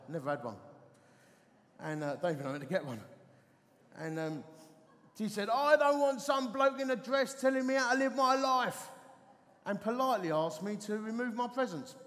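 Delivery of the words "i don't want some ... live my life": loud, higher mock outrage; indignant telling-off. Woman tells off the vicar at her door, mock indignant. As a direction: act out a householder sending the preacher packing. Woman angrily sends preacher away.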